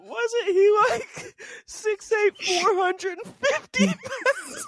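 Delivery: squeaky, breathless laughter through words; barely getting it out for laughing; laughing hard. Real, not acted. Cracking up mid-question.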